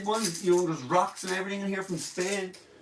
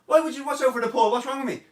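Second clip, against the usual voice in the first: confused protest; 6 semitones higher, 3 dB louder, much faster than usual. Retold or imitated. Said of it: "I'm like".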